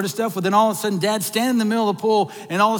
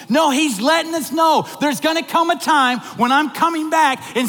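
excited; 4 semitones higher than usual. Real, not acted. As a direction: stir the church up with joy. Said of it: "That gets me excited".

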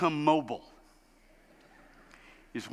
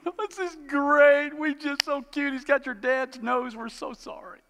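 higher, slow cooing grandparent voice; gushing grandparents; 9 semitones higher, slower than usual. Retold or imitated. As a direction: coo like doting grandparents. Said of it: "Grandparents are going".

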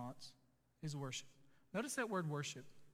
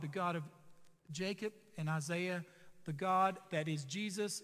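usual speaking voice

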